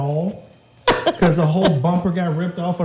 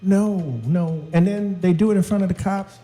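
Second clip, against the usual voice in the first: normal talking voice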